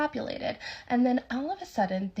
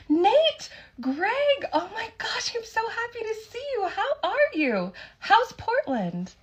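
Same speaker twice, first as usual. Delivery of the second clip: put-on much higher, gushing hello to old friends; overjoyed greeting; 13 semitones higher, 4 dB louder, slower than usual. Waitress greeting two strangers as old friends, high gushing delight. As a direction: squeal their names like long-lost pals. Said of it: "with a huge smile on her face".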